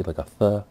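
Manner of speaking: Spaniard-style th for c; lisped th sound. Shown as a demonstration. Explaining his Spaniard accent, says th again. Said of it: "I pronounce it with the".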